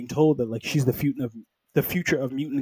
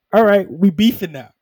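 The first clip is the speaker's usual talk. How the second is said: louder, higher playful declaration of battle; mock fight challenge; 6 semitones higher, 9 dB louder than usual. Two teams squaring off over the girl, mock challenge.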